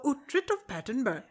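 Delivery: high-pitched mock Scandinavian accent; Uhtred. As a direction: echo it in a put-on Nordic accent. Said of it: "Just speak like Uhtred from Uhtred".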